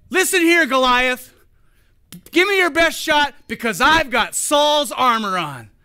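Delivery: P=A; cocky boast. Pretend. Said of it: "He didn't go out there and say".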